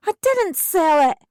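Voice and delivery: high, loud protest; yelled denial. Acted. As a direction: yell it back at the officer. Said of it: "I yelled".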